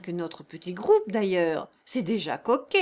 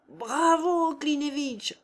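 mocking cheer, raised; cheering crowd; 6 semitones higher, slower than usual. A narrator's reading. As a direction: cheer him on like a rowdy crowd. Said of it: "hurlèrent les voix".

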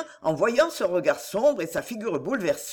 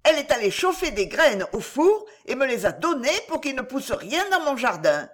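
put-on high-pitched little boy tattling; indignant high child voice; aggrieved child; 5 semitones higher than usual. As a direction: pour out the grievance like a cross child. Boy tells on his sister, quarrel.

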